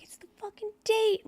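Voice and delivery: high-pitched mock crying voice; retold tearful outburst. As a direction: let it leap up high, imitating tearful exasperation. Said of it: "Why are you crying?".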